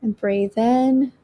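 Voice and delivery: slow, calm breathing guide; guided meditation. Acted. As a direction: ease them through each breath.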